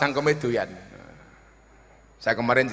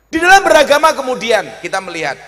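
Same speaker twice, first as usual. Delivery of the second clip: high, loud speechmaking; forceful oratory; 9 semitones higher, 9 dB louder than usual. Real, not acted. Preacher opening a new point to the congregation, forceful oratory. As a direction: lift it out over the crowd.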